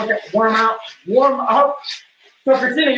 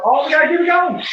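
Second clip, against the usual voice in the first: psych up yell; 8 semitones higher than usual. Real, not acted. Pushing through hula hoop workout.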